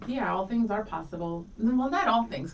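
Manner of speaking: ordinary delivery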